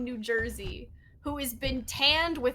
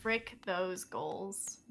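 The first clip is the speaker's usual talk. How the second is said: "Frick those goals" drawn out slow, much lower and softer; mock solemn; 7 semitones lower, 12 dB quieter, much slower than usual. Caster echoing her partner, "Frick those goals" low and solemn. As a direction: murmur "Frick those goals" like a grave vow.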